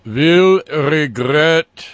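slow, loud, drawn-out threat; menacing Putin voice. Retold or imitated. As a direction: growl it like a dictator.

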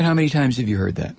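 ordinary delivery

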